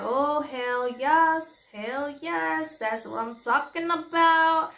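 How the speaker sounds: high and slow, relishing every word; stretched-out thrilled cheer; excited cheering. Retold or imitated. As a bystander at the pool, she cheers the men on, high and stretched.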